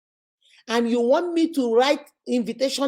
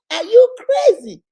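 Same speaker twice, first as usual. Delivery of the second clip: high, loud, slow are you crazy; outraged shout; 9 semitones higher, 8 dB louder, slower than usual. Real, not acted. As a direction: yell it in disbelief. Outraged at the entitled request.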